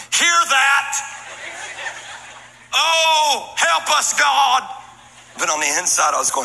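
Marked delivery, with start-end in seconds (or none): 0.1-4.6 mock pastor call-out. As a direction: play the showy pastor turning to the church. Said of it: "pastors will turn around to the church and we'll go".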